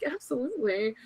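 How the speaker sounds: softly menacing in character; mock creepy answer. To fans wanting photos, a hushed masked-killer reply. Fans ask for mask pictures.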